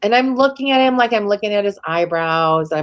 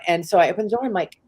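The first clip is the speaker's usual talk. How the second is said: normal talking voice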